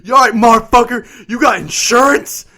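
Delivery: loud, high imitation of an irate biker; acted-out angry old man; mock angry confrontation. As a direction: confront the driver, loudly demanding. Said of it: "I think he was just angry".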